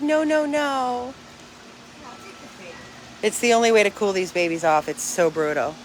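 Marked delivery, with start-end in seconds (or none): 0.0-1.1 slow, higher pleading dog voice; the dog refusing to climb down, in a slow, higher put-on voice. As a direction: speak for the dog, whining no. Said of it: "He's like".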